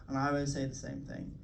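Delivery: usual speaking voice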